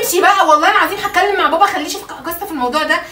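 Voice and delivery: loud, high furious threat; angry threat. Real, not acted. Wife threatening to tell her father about him, furious shout. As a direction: warn him you'll tell your dad.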